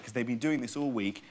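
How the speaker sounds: ordinary delivery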